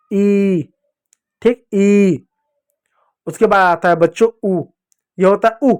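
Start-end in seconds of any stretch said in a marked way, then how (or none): 0.1-2.3 "ई" stretched with the mouth open wide; long ee. Shown as a demonstration. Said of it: "मुंह खोल के आता है".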